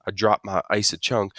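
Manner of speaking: ordinary delivery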